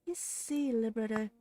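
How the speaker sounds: smug villainess explaining her scheme; smiling menace. A narrator's reading. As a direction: savour every word. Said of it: "hear the voice smiling".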